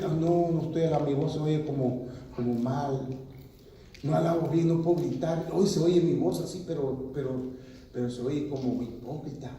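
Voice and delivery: soft, low, self-doubting mumble; a believer too shy to praise; mock timid believer. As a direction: shrink into a timid whine. Imitating timid insecure believer.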